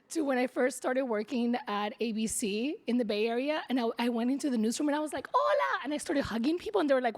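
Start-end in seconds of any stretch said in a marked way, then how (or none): 5.3-5.8 bright high burst of a greeting; excited hola. Retold or imitated. Reliving her first day, cheerfully greeting newsroom coworkers. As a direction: call it out like you're thrilled to see everyone.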